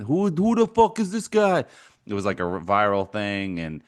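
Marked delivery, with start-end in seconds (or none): 0.0-1.7 imitating an incredulous rant, shooting up high and loud on the last words; retelling Rappaport's outburst, building to a shout; mock rant. Podcaster imitating Rappaport's viral draft rant, exasperated shout. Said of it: "Rappaport had that famous rant".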